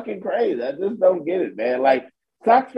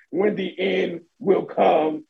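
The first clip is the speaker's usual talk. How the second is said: mock solemn doom. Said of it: "like he was lowering a casket".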